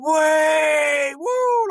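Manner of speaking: high whooping shout from the pub crowd; imitated drunken crowd shouting. Drinkers outside a pub whooping at the taxi, loud whooping shout. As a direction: whoop it like drunk pub-goers singing at a cab. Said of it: "proper shouting".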